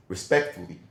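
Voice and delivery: deadpan respectfully. Retold or imitated. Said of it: "but then he says".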